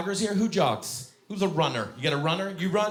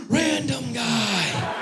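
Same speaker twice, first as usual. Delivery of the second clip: high, drawn-out panicked shout; 12 semitones higher, 3 dB louder, much slower than usual. Retold or imitated. Comic retelling his reaction to the caller, panicked. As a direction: cry out the name in mock panic. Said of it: "now I'm panicked".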